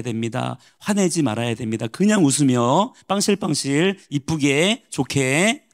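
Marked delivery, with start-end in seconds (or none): 1.9-5.7 slow, sing-song fake niceness; mock sweetness. Preacher mimicking believers who hide anger, slow mock-sweet. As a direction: draw it out with a phony smile. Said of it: "가식이라고 하는 거예요".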